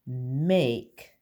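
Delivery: slow, clear reading of the answer; slow modelled sentence. Shown as a demonstration. Teacher to kindergarten class, slow and deliberate. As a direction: read it slowly so children follow. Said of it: "That's right".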